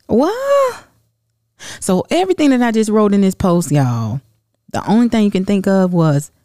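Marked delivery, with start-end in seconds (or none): 0.1-0.9 shrill high disbelief; sudden high yelp of disbelief; incredulous what. Real, not acted. Reacting to mask criticism.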